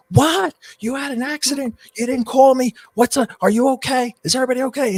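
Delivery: high-pitched, loud alarmed questions; imitating a son's shocked outburst; freaking out. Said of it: "he's, like, freaking out".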